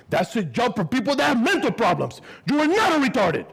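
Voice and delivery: high, loud outraged father; ranting dad. Retold or imitated. Dad ranting that the job is beneath his son, high and loud. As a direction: rant it, rising to a shout.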